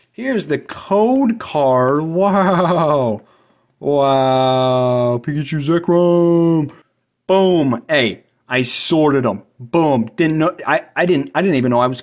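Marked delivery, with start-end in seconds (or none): 0.1-7.8 slow, high, awed reveal; mock awe. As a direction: draw out the wow in fake wonder. Showing off a card.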